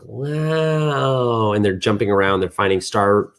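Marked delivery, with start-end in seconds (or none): 0.0-1.5 amazed wow. Acted. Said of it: "They're like".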